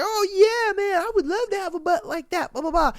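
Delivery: hammed-up imitation of her chiming in; mock Chelsea. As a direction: squeal it like a gushing girl. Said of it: "was just like".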